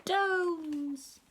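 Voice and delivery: put-on high, long-drawn toddler call; high baby-talk announcement; baby shouting. A narrator's reading. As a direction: pipe it high with D for J. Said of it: "just about to say".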